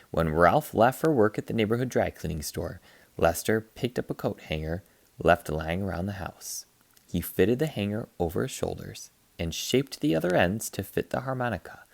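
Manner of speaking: plain reading voice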